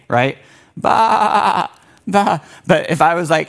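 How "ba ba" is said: high bleating ba, ba; sheep bleat. Shown as a demonstration. Youth pastor showing what sheep say, bleating. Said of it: "We all know what sheep say".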